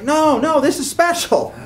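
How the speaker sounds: loud, high-pitched protest; emphatic insistence. Retold or imitated. Lover defending a new romance to a doubter, emphatic protest. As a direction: insist loudly that it's different.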